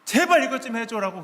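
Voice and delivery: mock-angry wife begging him to just do it; wife's angry plea. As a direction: plead like a fed-up wife at the end of her rope. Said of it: "아내가 막 화가 나가지고".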